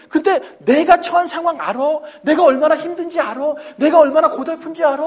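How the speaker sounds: loud, high indignant complaining; a defeated person shouting excuses; whining protest. Retold or imitated. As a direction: protest your innocence at full volume. Voices a self-justifying whiner.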